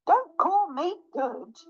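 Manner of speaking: high, slow, affronted retort; indignant mouse. Acted.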